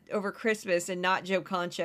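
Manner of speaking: ordinary delivery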